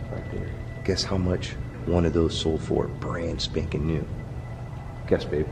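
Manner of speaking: hushed lobby whisper; nervous night vlog whisper. Real, not acted. Hushed talk in hotel lobby.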